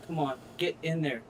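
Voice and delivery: ordinary talk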